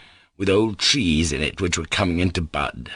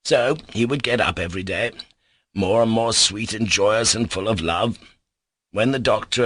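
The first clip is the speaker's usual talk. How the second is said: ordinary delivery, nothing marked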